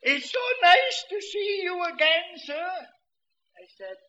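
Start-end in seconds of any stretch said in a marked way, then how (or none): 0.0-2.7 put-on high, loud eager old man; an old man thrilled to meet him; loud high old man greeting. Old man voiced in story.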